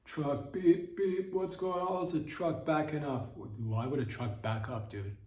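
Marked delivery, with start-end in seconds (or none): 0.1-0.5 higher, sing-song sarcastic voice; sarcastic mimic of a staged scene; mock dumb narrator. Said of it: "So realistic, too". 0.5-1.3 high beep imitation, louder; playful backing-up beep; mock truck beeping. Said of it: "So realistic, too". 1.3-3.3 higher, sing-song sarcastic voice; sarcastic mimic of a staged scene; mock dumb narrator. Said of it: "So realistic, too".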